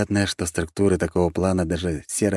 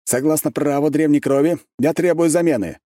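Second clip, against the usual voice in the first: ringing formal proclamation, raised voice; loud declaration; 9 semitones higher, 4 dB louder than usual. A narrator's reading. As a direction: announce it loudly to the room. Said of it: "громко произнёс".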